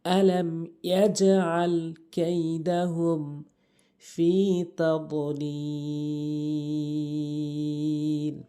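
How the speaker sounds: slow chanted recitation; Quran recitation. Shown as a demonstration. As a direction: draw out the last word melodically. Recites the verse.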